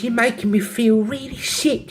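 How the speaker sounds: high put-on London accent. Second London girl upset by the symptoms, London accent. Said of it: "have a go at the accents".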